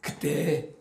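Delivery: ordinary talk, nothing marked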